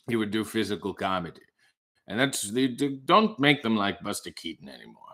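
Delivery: low, ponderous storyteller impression; Orson Welles. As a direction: reminisce like a grand old raconteur. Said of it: "Orson Welles is talking about".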